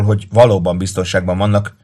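plain reading voice

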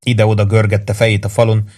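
ordinary delivery, nothing marked

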